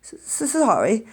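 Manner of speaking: slow, stammering through sobs; sobbing. A narrator's reading. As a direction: choke out the apology. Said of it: "sobbed Hagrid".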